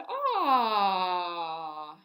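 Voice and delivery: loud open yawn on ah; vocalized yawn. Shown as a demonstration. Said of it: "And vocalize the yawn".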